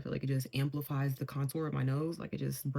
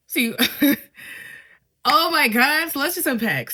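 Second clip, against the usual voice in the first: loud high laugh into words; real laughing outburst; 9 semitones higher, 11 dB louder than usual. Real, not acted. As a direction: crack up at the memory. Laughing at the memory.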